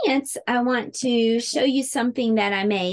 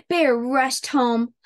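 usual speaking voice

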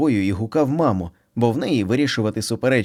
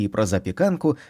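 ordinary delivery, nothing marked

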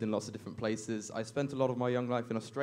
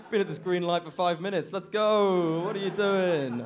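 high, loud impatient complaint; exasperated yelling; 6 semitones higher, 7 dB louder, slower than usual. Acted. Passenger to cab driver at a green light, exasperated yell. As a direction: yell at a driver who won't move.

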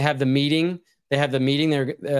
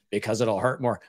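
mock menacing sheriff; 5 semitones lower than usual. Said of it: "Alan Rickman is a sheriff".

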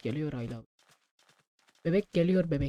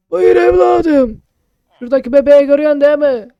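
put-on high loud old man voice; old shopkeeper voice; 7 semitones higher, 13 dB louder than usual. Player voicing shopkeeper Ahmet, high and loud. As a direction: answer like a hearty old uncle.

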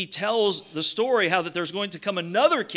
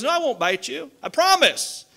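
lightly acted snake promise, pitched up; reassuring snake; 7 semitones higher, 5 dB louder than usual. Snake swearing to the climber it is harmless, lightly acted insistence. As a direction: plead innocence in a higher storyteller's voice. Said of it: "He goes".

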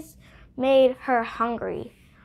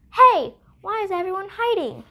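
puzzled little bug; 8 semitones higher, faster than usual. Acted. Baby Bug puzzled by hiding.

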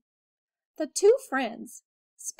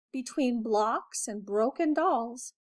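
usual speaking voice

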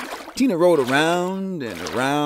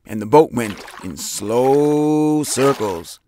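ordinary delivery, nothing marked

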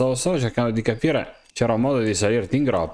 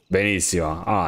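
normal talking voice

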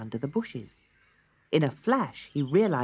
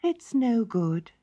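sighing; 7 semitones higher, slower than usual. Acted. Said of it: "sighed the nightingale".

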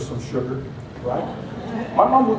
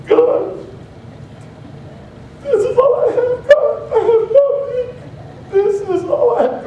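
high, whimpering whine with a laughing sob; whiny complaint; slower than usual. Acted. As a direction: whine to God that you have nothing, half laughing. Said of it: "we tell God, "This is all I have".

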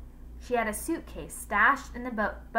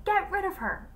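high-pitched, insistent command; shouted order; 11 semitones higher than usual. A narrator's reading. Wife orders the butler to remove the visitor, high sharp order. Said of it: "shouted Jane".

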